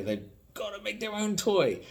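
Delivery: high, straining put-on voice; mock desperate enthusiasm, pitched high; passionate wannabe toymaker. Mocking people desperate to make their own toy, high mock-passionate voice. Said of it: "who are really passionate about".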